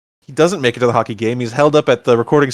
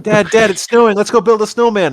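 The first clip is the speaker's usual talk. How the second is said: higher-pitched imitation of an excited kid; mock an eager child pestering Dad; 6 semitones higher than usual. Voicing an eager kid in retelling.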